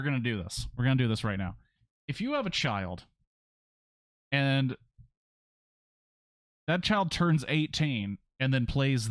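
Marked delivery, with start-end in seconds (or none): none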